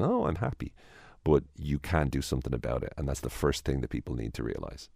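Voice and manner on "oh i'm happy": higher, fake sudden cheer; mock instant happiness. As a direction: flip to happy like a switch. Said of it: "like a magic switch where you just click your fingers".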